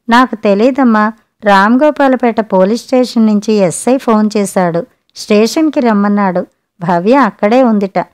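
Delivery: plain reading voice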